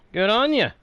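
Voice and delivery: put-on a light, playful Australian accent. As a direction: toss off a friendly Aussie "mate" line, lightly put on. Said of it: "as I understand Australians sometimes say".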